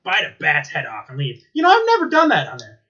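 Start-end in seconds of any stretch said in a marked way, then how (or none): 1.5-2.8 higher, louder imitation of a guest; a quick put-on voice. Imitating [name] reply.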